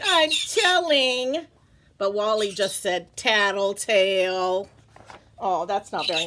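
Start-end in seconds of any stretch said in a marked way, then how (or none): 0.0-1.5 slow, high, indignant; tattling cry. Acted. Lola threatening to tell, slow and indignant. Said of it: "Lola cried". 3.2-4.7 slow, drawn-out taunt. Acted. Wally taunting Lola, drawn-out.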